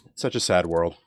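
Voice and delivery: low, soft pretend lament; put-on downcast sigh of a line; mock sad. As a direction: playfully feign dejection, voice dropping low and quiet. Mock lament, then admits kidding.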